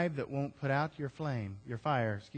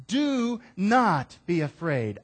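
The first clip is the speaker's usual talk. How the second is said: loud, slow, higher Do not be afraid; emphatic reassurance; 5 semitones higher, 6 dB louder, slower than usual. Acted. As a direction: drive it home slowly. Sermon, God's promise repeated.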